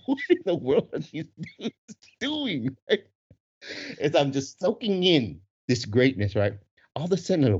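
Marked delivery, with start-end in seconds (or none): 0.1-2.8 higher-pitched amused bewilderment; mock bewilderment. Wife and girls watching the dudes dance, laughing bewilderment. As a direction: voice the baffled onlookers through your own laughter.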